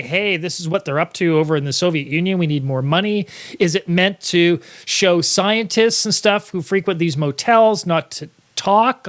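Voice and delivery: ordinary talk, nothing marked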